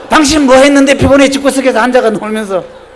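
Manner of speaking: mock angry wife. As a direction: play the irritated wife scolding her husband. Imitating a wife's angry comeback. (화난 아내 흉내)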